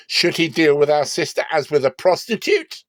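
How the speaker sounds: high, loud and outraged; indignant brothers. Acted. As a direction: throw the question back in defiance. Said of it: "They said".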